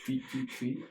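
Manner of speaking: unvoiced whispered repeat; whispering. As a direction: breathe the words out. Whispered creepy echo.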